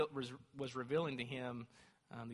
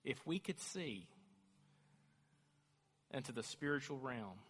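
hushed preaching; 6 dB quieter than usual. Acted. Preacher describing the angelic host, hushed awe. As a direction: draw them in quietly.

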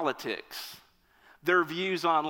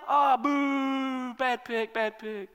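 high boo fading to a grumble; a disappointed fan booing the draft pick; mock booing; 5 semitones higher, slower than usual. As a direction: jeer then trail off.

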